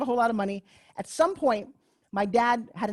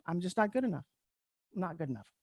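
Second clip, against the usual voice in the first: soft, lowered inner voice; quiet self-doubt; 4 semitones lower, 8 dB quieter, faster than usual. Retold or imitated. Inner voice after never getting nominated, quiet self-doubt. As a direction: say it small, sinking softer as a private thought.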